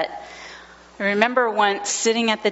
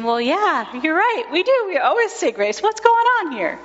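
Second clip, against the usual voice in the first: rising, high, bewildered inner voice; baffled parents wondering aloud; higher, puzzled, half-amused; puzzled thinking; 8 semitones higher than usual. Retold or imitated. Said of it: "all looking at each other thinking".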